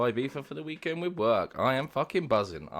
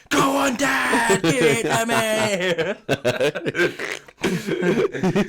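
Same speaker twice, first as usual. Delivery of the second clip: high, loud cheering shout; excited shout; 11 semitones higher, 7 dB louder than usual. Retold or imitated. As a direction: cheer him on at full voice. Retold peeping, shouting.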